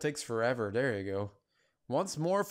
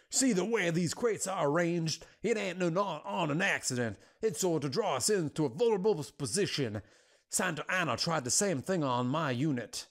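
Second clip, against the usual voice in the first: hammed-up cowboy recollection; Old West lieutenant; 3 semitones higher than usual. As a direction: narrate like a frontier soldier. Resumes the western lieutenant's voice.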